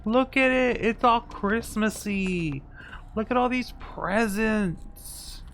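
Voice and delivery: high-pitched, loud delighted gushing; delighted squeal. Real, not acted. Delighted by festive Christmas map.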